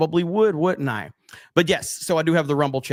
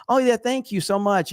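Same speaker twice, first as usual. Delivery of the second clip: mock polite girl; 7 semitones higher than usual. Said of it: "And she goes".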